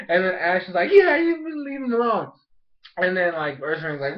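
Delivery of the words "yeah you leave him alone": high-pitched indignant mimicry; Ash backing her up. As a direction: chime in with a high, mock scolding. Ash joins the scolding, acted.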